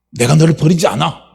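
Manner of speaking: loud, emphatic assurance; firm promise. Acted. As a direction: promise it forcefully. God insists, voice building.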